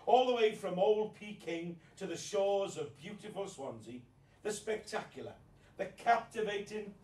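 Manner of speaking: showman's big announcement; grand introduction. Acted. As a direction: build it up like a compere. Introduces guest like compere.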